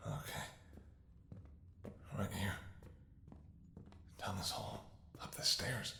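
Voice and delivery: quick whispered directions; soft whispered guiding. Acted. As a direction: hush the directions as you sneak. Guiding the escape in secret.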